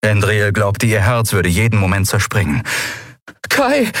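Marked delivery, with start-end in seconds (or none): 3.5-3.9 high urgent call. Acted. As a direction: call him. Calling for Kai.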